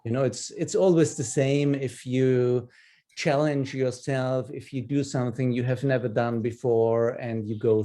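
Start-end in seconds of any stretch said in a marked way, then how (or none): none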